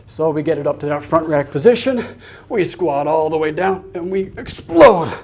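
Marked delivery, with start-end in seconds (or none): 4.4-5.1 high, falling burst on the lift; effortful lifting cue; exertion call. Real, not acted. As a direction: call it out as you drive the weight up. Calls out while lifting the weights.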